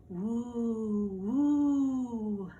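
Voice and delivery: long hollow woo of the wind; wind howl. A narrator's reading. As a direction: moan it like wind through trees. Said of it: "the wind blew in the trees".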